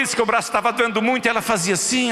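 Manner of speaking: ordinary talk, nothing marked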